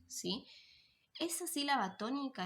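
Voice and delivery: ordinary delivery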